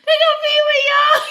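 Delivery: high, loud shout through laughter; laughing shout. Real, not acted. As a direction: yell it out while cracking up. Yells the call, half crying.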